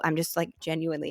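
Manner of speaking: usual speaking voice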